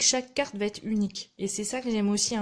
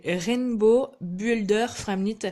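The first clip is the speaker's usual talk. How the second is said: louder, slow English in a French accent; careful, slow French-accented product name; 5 dB louder, slower than usual. Real, not acted. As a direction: sound out "Rainbow Builder Framlit" with a French accent. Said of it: "je suis désolée pour mon accent anglais".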